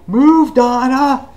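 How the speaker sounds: high, loud, slow drawn-out yell; urgent mock shout. [name] orders Donna out of danger, high mock shout. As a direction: holler a hammed-up order.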